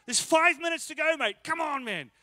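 dad yelling high and loud to his son on the pitch; urgent parental yell from the sideline. Retold or imitated.

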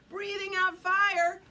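plain reading voice

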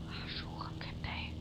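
whispered under breath; whispered insult. Real, not acted. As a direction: hiss it quietly under breath. Muttered insult after shout.

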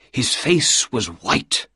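usual speaking voice

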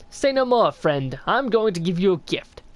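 lightly voiced sly old snail, curious; a hint of a creaky old mystic greeting a stranger; snail shaman voice. Streamer reading the shaman's greeting to the knight, lightly voiced old mystic. Shaman offers a spell, cackles.

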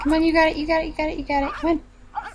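high, eager cheering-on; urging on. Real, not acted. Player to game character giving birth, urging her on.

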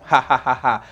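real ha ha ha laugh; laughing at own joke. Real, not acted. Teacher laughs at her red-shoes joke, real laughing.

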